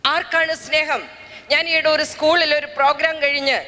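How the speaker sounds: normal talking voice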